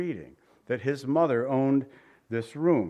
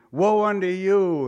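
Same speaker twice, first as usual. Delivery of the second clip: louder and slow, pronouncing judgement; condemning woe; 5 semitones higher, 6 dB louder than usual. Retold or imitated. The self-righteous judging other people, loud slow condemnation. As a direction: point the finger and pronounce it. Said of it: "saying outwardly or secretly".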